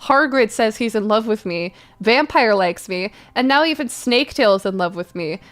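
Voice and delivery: usual speaking voice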